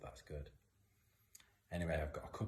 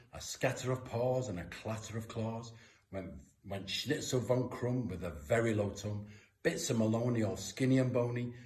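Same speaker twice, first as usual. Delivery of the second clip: higher, louder galloping chase refrain; excited storytelling; 6 semitones higher, 9 dB louder than usual. A narrator's reading. Reading the chase scene to kids, high and loud with excitement. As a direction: rattle off the dog chase with building excitement.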